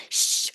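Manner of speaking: one sharp shush; imitated shushing. Whole audience hushing a moviegoer, imitated shush. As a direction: shush like an impatient crowd. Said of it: "the whole audience was going".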